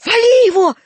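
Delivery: high, loud battle cry; shouted command. Acted. Драп urges boys to attack old man, shouted command. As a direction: yell it to rally the gang. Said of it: "крикнул Драп".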